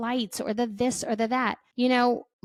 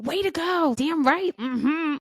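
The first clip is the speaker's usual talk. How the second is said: mock cheering; 7 semitones higher than usual. Mocking the urge to praise snapping at kids, sarcastic cheer. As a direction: cheer yourself on over the top. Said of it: "we're not saying".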